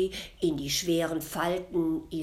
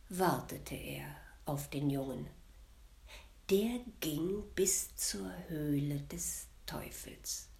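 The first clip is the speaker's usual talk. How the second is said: hushed narration; 3 semitones lower, 7 dB quieter than usual. A narrator's reading. Suspenseful narration, devil's cave.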